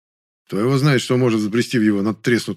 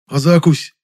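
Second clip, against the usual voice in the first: high, loud fretful question; preoccupied concern; 7 semitones higher, 7 dB louder, slower than usual. A narrator's reading. Жасминов remembers food is missing, concerned. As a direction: pipe up with sudden concern. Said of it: "озабоченно вспомнил Жасминов".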